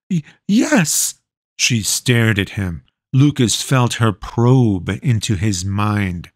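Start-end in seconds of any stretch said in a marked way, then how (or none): 0.1-1.2 high, timid stutter; scared boy answering the witch; nervous stammer. A narrator's reading. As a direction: barely get the word out.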